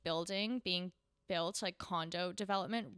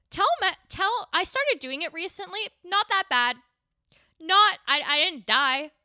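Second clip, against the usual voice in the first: loud ranting; 9 semitones higher, 12 dB louder than usual. Real, not acted. Loud excited rant to listeners.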